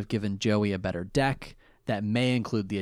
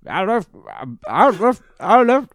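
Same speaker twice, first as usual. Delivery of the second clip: cracking higher and louder with each try; failed killer voice; 8 semitones higher, 8 dB louder than usual. Retold or imitated. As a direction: keep restarting "I left" as the voice slips up. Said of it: "I can't do the voice".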